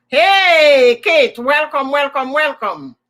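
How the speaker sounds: high, loud welcome for a guest; loud cheerful greeting. Retold or imitated. Host calling out a welcome to Kate, bright and loud. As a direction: greet her like a beaming host.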